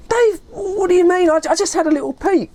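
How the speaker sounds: high-pitched, puzzled protest; confused. Retold or imitated. Friend defending a little peek to Dave, confused. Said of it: "I was really confused".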